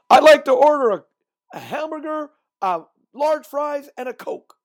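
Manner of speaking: a customer yelling an order at the drive-through; yelled order. Retold or imitated. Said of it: "yell it out".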